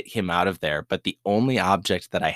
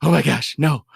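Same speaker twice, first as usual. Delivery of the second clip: high, loud panicked "oh my gosh"; panicked cry; 7 semitones higher, 5 dB louder than usual. Retold or imitated. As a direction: squeal it out in a fright. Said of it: "And she freaked out".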